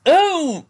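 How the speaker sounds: pained yelp. Acted. Bully struck, cry of pain.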